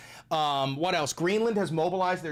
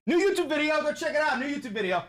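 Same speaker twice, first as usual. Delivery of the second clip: high, loud, hyped-up promo; excited plug; 12 semitones higher, 4 dB louder than usual. Real, not acted. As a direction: shout the plug with glee. Excited plug of new video.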